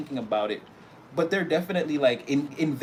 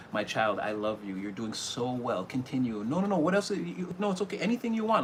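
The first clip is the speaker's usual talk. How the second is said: tender divine voice; 2 semitones lower, 3 dB quieter than usual. Pretend. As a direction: soothe your child gently. The Most High comforting you.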